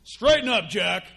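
loud, stern order; mock-stern God barking a command. Stern God ordering a believer to straighten up, sternly. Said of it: "very stern? Do you see him stern?".

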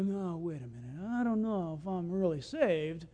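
low, soft hesitant protest; mock doubting. Believers doubting they are saved, mock hesitant. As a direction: play a wavering, unsure believer.

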